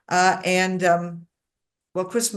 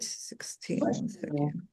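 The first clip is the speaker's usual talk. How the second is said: whispered to himself while searching; under the breath, just checking the page; soft whisper, finding his place; muttered aside; 5 semitones lower, 11 dB quieter, slower than usual. Real, not acted.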